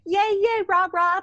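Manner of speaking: lightly mocking high-pitched cheerleading; mock cutesy cheer. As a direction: imitate a too-sweet cheer. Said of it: "like, cutesy".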